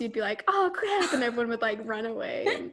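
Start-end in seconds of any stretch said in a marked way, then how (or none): 0.5-1.1 mock panic. Acts panic at police helicopter.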